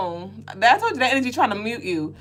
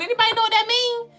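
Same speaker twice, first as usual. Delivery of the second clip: comic question; 13 semitones higher, faster than usual. Real, not acted. Asking viewers, comic.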